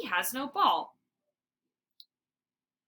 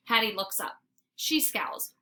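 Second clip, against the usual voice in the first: usual speaking voice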